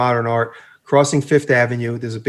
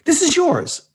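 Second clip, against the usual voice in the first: very high, disbelieving question; incredulous question; 13 semitones higher, slower than usual. Retold or imitated. Some guy to Vespa owner outside apartment, incredulous question. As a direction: pitch way up in mock disbelief. Passer-by asks again in disbelief.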